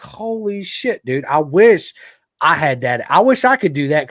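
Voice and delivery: high, loud awestruck envy; retold amazement. Retells amazement at son's accent.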